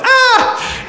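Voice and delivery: high startled mock shriek. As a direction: shriek in mock panic at the news. Other half reacts in alarm.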